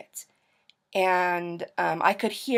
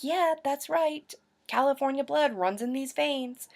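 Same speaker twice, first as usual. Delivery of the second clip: mock swagger; 7 semitones higher than usual. Recalling her hunch about California, mock swagger. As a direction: savor it in a higher, playful voice. Acting out proud reaction to California news.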